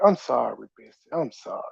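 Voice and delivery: mock apology. Showing her how her line sounded, mock apology. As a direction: mimic a sorry that sinks away quietly. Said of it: "It sound like you was like".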